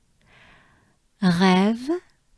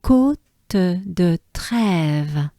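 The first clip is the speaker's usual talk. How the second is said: Côte de Trèves very slow and deliberate; much slower than usual. Shown as a demonstration. Teacher carefully models Côte de Trèves, very slow. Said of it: "So we have".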